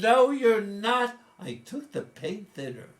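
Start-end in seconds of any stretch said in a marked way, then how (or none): 0.0-1.2 high, loud, slow flat-out no; curt denial. Retold or imitated. Mother loudly denying her son's effort, slow and high. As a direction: shut him down loudly and slowly. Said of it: "She said".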